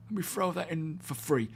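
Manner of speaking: "throw" said as "frow", with F for TH; English-accent demo, "throw" becomes "frow". Shown as a demonstration. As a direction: say "throw" with an F at the start. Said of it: "pronounce your T H's with an F".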